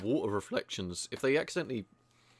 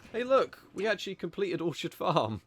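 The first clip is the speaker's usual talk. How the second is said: excited announcement; 10 semitones higher than usual. Real, not acted. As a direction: pipe up high in pleased surprise. Cheers completing a game objective.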